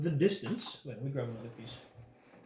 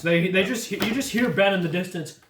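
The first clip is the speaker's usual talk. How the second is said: ordinary talk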